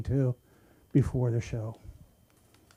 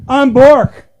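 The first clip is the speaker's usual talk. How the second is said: loud, higher self-announce; loud self-intro; 10 semitones higher, 13 dB louder than usual. Real, not acted. Comic to crowd, a loud self-announcement. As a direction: announce yourself big.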